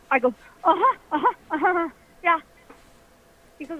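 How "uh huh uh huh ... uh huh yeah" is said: frantic breathless uh-huh. Retold or imitated. Breathless frightened nodding.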